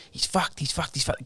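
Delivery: breathless whisper, barely voiced; whispered aside. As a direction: lean in and whisper it to a teammate. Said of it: "'Cause he goes".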